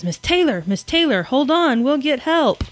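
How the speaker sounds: a touch of acted panic, rising to the end; mock alarm. Calling to the collapsed reporter to hold on, mildly acted alarm.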